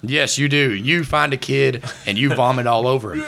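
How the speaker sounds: usual speaking voice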